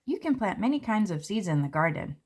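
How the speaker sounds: usual speaking voice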